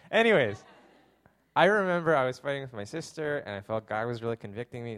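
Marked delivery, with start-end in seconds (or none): none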